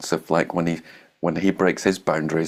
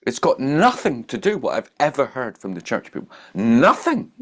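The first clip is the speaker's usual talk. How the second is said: excited emphasis; 8 semitones higher, 4 dB louder than usual. Real, not acted. Excited contrast with church.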